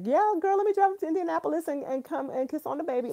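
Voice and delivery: high, fast, playful over-the-top excitement; mock eager gush. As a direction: send yourself up as a gushing fan. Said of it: "I'm like".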